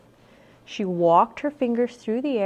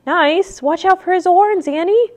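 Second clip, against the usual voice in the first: high, loud, incredulous cry; alarmed warning; 10 semitones higher, 11 dB louder than usual. A narrator's reading. Jack warns Annie about the horns, loud and alarmed. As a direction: warn her urgently, pitched up high.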